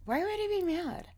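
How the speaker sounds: high-pitched, incredulous question; imitated puzzled reassurance; retold baffled reply. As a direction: ask it high, as if the idea is absurd. Reassuring friend who came out.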